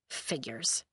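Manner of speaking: soft low sigh then muttered word; quiet bitter sigh; resigned sigh. Acted. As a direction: let it fall bitterly.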